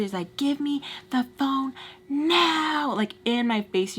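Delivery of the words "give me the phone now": drawn-out, higher-pitched imitation of an angry demand; retold yell. Imitating him demanding her phone in her face, mock yelling. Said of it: "just screaming at each other".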